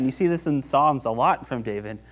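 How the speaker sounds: ordinary delivery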